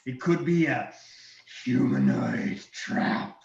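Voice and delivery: loud, slow, wary dragon. Acted. Dragon debating with himself, wary dragon voice. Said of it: "And then he goes".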